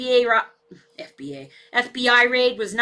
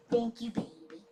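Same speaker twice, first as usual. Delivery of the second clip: soft thanks; 12 dB quieter than usual. Real, not acted. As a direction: murmur it to the pet. Thanking the dog.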